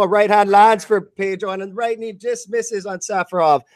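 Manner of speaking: ordinary talk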